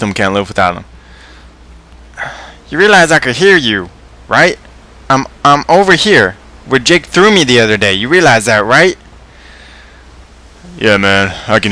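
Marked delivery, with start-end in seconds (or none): 2.7-9.0 high, swooping toy voice; high-pitched, reproachful little plush toy; hurt Mister Snuggles. Acted. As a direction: whine as an overheard, neglected stuffed toy.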